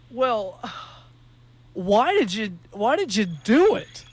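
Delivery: a drawn-out, disbelieving question; lightly voiced puzzled interviewer; baffled reporter.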